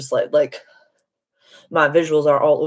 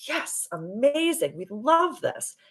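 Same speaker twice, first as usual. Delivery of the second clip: high, slow, drawn-out delight; delighted approval; 9 semitones higher, 4 dB quieter, slower than usual. Acted. Spirit guides cheering her on while driving, delighted. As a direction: gush it high, stretching each word.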